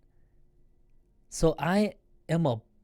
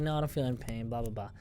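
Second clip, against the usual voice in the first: groggy mumble; 4 semitones lower, 9 dB quieter, faster than usual. Retold or imitated. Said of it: "I'm like".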